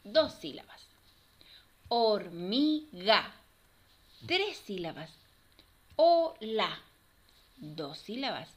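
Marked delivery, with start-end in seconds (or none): 1.9-3.3 slow "Hor-mi-ga" in three beats; teacher counting out each syllable of "Hormiga". Shown as a demonstration. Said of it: "vamos a separar cada una". 6.0-6.8 loud "O-la" in two beats; teacher segmenting "Ola" for children; syllable by syllable. Shown as a demonstration. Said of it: "vamos a separar cada una".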